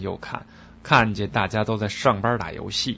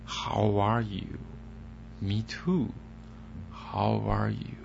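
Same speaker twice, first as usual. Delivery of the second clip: quiet, slow rehearsing of How are you; practising under breath; 9 dB quieter, much slower than usual. Acted. Kim Jong-il quietly practising English on the plane. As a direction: mumble it over to yourself. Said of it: "就在飞机上啊就练呐".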